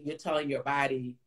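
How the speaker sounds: normal talking voice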